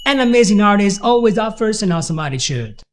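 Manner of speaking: An amazing artist said fast, words run together; fast linked demo. Shown as a demonstration. Teacher showing linking at full speed. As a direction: rattle it off in one smooth run. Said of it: "connect every word to the next".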